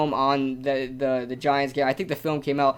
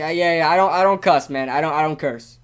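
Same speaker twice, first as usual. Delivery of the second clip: ordinary talk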